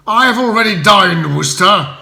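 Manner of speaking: loud, clipped threat; menacing. Acted. Spode warning Bertie in a loud menacing voice. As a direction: lean in and menace him. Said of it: "answered back in a menacing tone".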